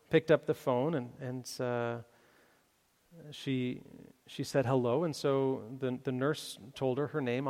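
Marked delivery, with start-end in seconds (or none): none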